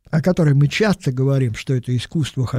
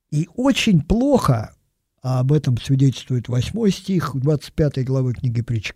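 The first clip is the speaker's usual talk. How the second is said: ordinary talk, nothing marked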